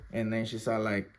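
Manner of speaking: normal talking voice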